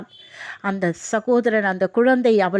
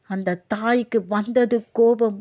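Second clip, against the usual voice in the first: ordinary talk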